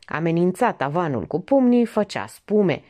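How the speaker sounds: usual speaking voice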